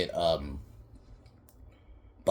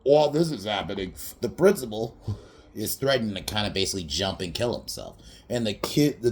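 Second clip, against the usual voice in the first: usual speaking voice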